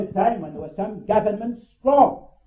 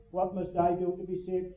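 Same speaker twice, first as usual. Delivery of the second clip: softer, said off like a repeated phrase; rote recital; 4 semitones lower, 8 dB quieter than usual. Shown as a demonstration. Preacher showing how the phrase is usually repeated, rote. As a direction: recite it by rote without feeling. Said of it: "as we often have them repeated to us".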